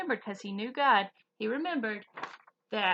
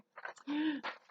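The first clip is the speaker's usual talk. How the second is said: gasp; 6 semitones higher, 4 dB quieter than usual. Acted. Mock shock before anger question.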